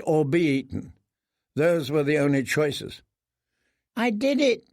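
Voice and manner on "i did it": frightened mumble. Acted. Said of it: "he mumbled".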